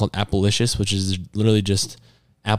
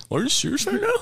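high mock disbelief; 4 dB quieter, faster than usual. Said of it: "He's like".

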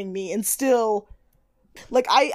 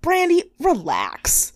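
drawn-out slow soothing; slow her down, unbothered; mock calm Naima; slow calm relax; 7 semitones higher, much slower than usual. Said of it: "just goes".

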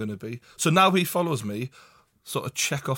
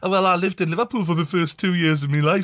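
higher, prissy take-off of his mate; mocking impression; 4 semitones higher than usual. Host mocks co-host's claim to be a real Liverpudlian, sneering impression. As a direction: send him up in a put-on voice.

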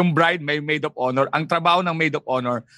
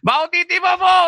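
teasing yell; 10 semitones higher, 5 dB louder than usual. Retold or imitated. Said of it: "pag nakita mo kami".

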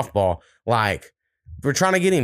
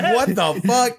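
mock shocked reply; 8 semitones higher, slower than usual. Own shocked reply to dad.

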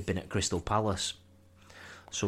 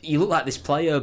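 higher, flustered, scrambling excuse; 7 semitones higher, 4 dB louder than usual. Retold or imitated. Fan explaining himself to an angry stranger, flustered and higher. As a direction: talk your way out of trouble, nervously. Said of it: "and I was like".

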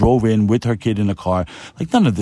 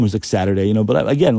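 ordinary talk

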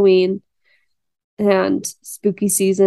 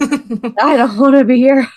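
high, loud, laughing through it; laughing whine; 5 semitones higher, 5 dB louder than usual. Retold or imitated. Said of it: "I'm like".

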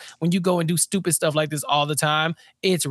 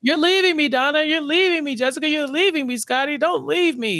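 mock whining plea; 9 semitones higher than usual. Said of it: "he's like".

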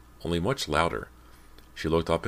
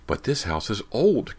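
insistent, higher and louder; insisting; 7 semitones higher, 5 dB louder than usual. A narrator's reading. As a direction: push back insistently. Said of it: "Cody insisted".

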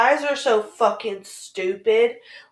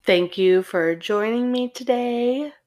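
calm, lower sign-off read; podcast outro; 5 semitones lower than usual. Acted.

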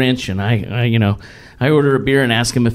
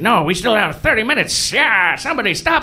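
Grinch voice; 6 semitones higher than usual. Retold or imitated. Grinch pleading to stay.

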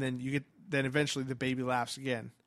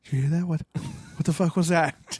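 louder, put-on spooked TV ghost hunter; mocking hosts of ghost hunting shows, hammed-up alarm; 3 semitones higher, 6 dB louder than usual. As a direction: parody a jumpy ghost hunting host.

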